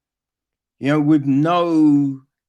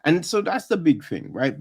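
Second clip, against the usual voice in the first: ordinary talk, nothing marked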